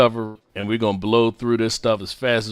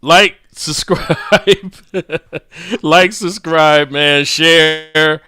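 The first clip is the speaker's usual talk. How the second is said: laughing; 4 semitones higher, 5 dB louder, much slower than usual. Real, not acted. Laughing through subscribe plug.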